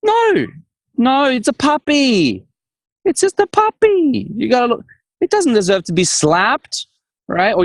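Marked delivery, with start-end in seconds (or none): 0.0-4.8 mock indignant. Host protesting that a puppy should never be slapped. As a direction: plead for the poor puppy.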